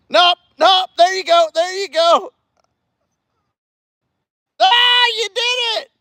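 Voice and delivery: high-pitched, excited encouragement; excited cheering. Real, not acted. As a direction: burst of delighted high-pitched praise. Cheering on nephew's stunt attempts.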